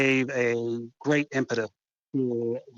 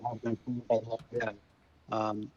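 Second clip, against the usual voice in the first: over the phone; 6 dB quieter than usual. Real, not acted. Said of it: "can you speak into your phone? 'Cause I'm losing your, your signal".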